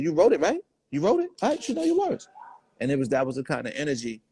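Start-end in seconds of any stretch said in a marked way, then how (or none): 0.0-2.3 mock incredulous mentor. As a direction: voice climbing much higher with each question. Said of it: "he said".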